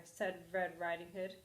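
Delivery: plain reading voice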